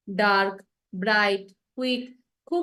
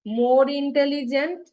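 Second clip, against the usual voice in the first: slow drawn-out demo; 3 semitones higher, much slower than usual. Shown as a demonstration. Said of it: "আবার কি বলি".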